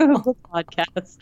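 slow, drawn-out words; mock ASMR slow-talk. Shown as a demonstration. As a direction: draw each word out deliberately. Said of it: "I'm, like, doing an A S M R".

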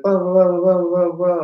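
high, loud babbling blah blah; mock French chatter. Imitating the French girl talking at him, high babble. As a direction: imitate fast foreign chatter. Mimicking girl's rapid French.

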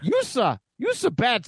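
high goofy alien voice; Jar Jar voice. Acted. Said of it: "Jar Jar".

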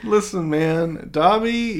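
slow, stretched appeal, Dobby up high; slow it down and plead; a mock-solemn "hear me out"; drawn-out plea. Real, not acted.

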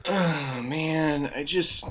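mopey sighing imitation of Joe; depressed Joe impression. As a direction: moan it out like a sad Joe. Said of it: "his terrible impression of me".

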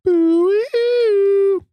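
high, wavering eerie "oo"; spooky future noise. Shown as a demonstration. Co-host sound-effecting a glimpse of the future, spooky. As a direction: slide a ghostly hoot up high. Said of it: "That's the future noise".

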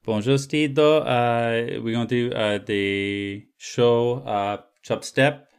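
hesitant put-on French accent; halting French accent, slowing right down. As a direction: stumble through it in a mock French accent. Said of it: "French accent".

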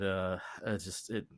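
normal talking voice

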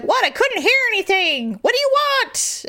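put-on impersonating a grumpy old man on the phone; old man answering his wife, impatient and high; cranky old man. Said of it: "he's like".

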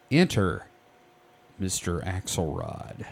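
low, hushed announcement with weighty pauses; dramatic introduction. Acted. Podcast host introduces a mysterious new character, low and dramatic. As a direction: announce him gravely, pausing before the name.

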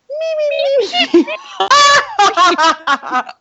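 high squeaky meeping shout through laughter; Beaker voice. Retold or imitated. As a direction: meep like a panicky Muppet. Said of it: "no, it's Beaker".

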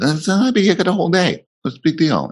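high, brushing-off retort to son; mock-casual dad scoffing at a panicking son; dismissive scoff. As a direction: wave it off, voice leaping high. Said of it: "he was freaking out".

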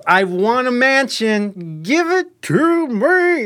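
hammed-up wail, high, loud and drawn out; mock demand. Mocking those who order the universe around, hammed-up loud demand. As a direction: demand it like an entitled mock wisher.

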